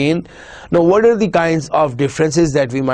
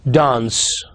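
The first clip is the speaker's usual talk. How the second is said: ordinary delivery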